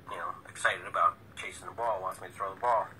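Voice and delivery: ordinary delivery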